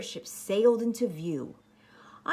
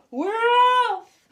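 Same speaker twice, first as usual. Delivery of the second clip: much higher, louder pirate cheer; crew shout; 10 dB louder than usual. Acted. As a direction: yell it with the whole crew. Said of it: "we all shouted".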